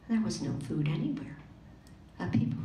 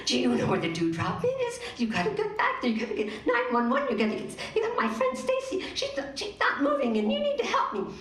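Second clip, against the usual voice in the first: frantic; 10 semitones higher, faster than usual. Acted. Morgan frantic to policeman.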